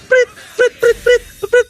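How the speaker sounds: high, sharp referee whistle sounds; whistle imitation. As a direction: blow it like a ref's whistle.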